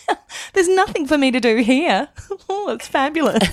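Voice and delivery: hammed-up posh praise of the wardrobe; imitating stylist. Guest imitating her stylist's imagined praise. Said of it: "I thought you'd come in and go".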